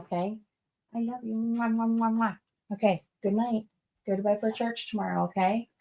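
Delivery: soft, tender mommy voice to her son; hushed and loving, saying good night. Real, not acted. Mother talks to son at home.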